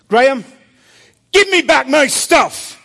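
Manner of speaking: high, loud and stern, calling him by name; loud stern demand. Retold or imitated. Jesus marching up the hill to Graham, loud stern demand. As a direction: order it back at full force.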